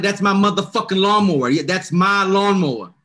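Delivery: loud, high and furious, slowing at the end; angry yell. Retold or imitated. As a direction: yell it in the thief's face. Confronting the lawnmower thief.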